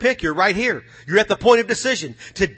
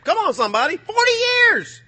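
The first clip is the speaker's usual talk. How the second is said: preacher's cry; 14 semitones higher than usual. Real, not acted. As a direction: stretch it out to lift the room. Preaching peak, high long cry.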